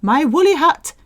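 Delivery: high lilting sing-song, quick; cheery sing-song. Shown as a demonstration. Teacher performs the English line to learners. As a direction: sing it out brightly. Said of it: "I'm putting on".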